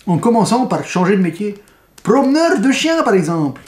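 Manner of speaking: mock Quebec accent, climbing high on promeneur de chiens; bad Quebec accent. Reviewer hamming the blurb for viewers in a fake Quebec accent. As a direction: overplay a Québécois lilt. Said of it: "il est nul, mon accent".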